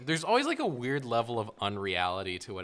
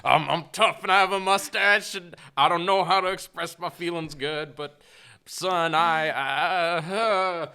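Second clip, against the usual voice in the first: put-on high, loud sad old man; Henry Thomas; 7 semitones higher, 9 dB louder than usual. Tough mustached father addressing his son, hammed-up sad old man. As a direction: ham up a tough man choking on his feelings. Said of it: "he's sad old man Henry Thomas".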